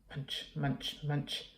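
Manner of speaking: soft munch, munch, munch; munching sounds. Acted. Monster munching in the dark, softly voiced. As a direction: chew the words like a snack.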